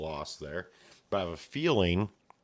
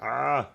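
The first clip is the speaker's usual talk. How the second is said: higher, louder cry of alarm; scared scream; 6 semitones higher, 4 dB louder than usual. Acted. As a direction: yelp as they are cut down. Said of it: "they're like".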